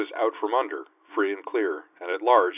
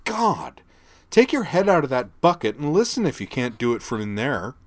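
loud, exasperated, higher eye-roll voice; teenage disdain; 6 semitones higher, 5 dB louder than usual. A narrator's reading. Teen girl mocking the dumb sergeant, loud disdain. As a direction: scorn him like a bratty teen. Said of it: "her voice full of teenage disdain".

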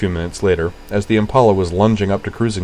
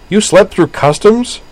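high, slow disbelief; can't believe he slept; disbelieving; 13 semitones higher, 7 dB louder, slower than usual. A narrator's reading. Utana demands, disbelieving.

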